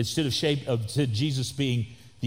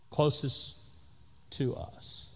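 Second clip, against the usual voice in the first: soft echo; 10 dB quieter, slower than usual. Acted. Echoing a line softly.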